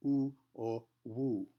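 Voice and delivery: "Oo or woo" slow, lips pushed round. Shown as a demonstration. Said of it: "we make the sound oo".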